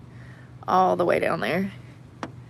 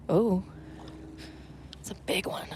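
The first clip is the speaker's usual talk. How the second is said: whisper; 14 dB quieter than usual. Real, not acted. Spotting a big fish quietly.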